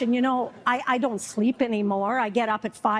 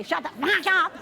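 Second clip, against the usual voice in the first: mock angry shushing; 5 semitones higher than usual. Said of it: "I get mad".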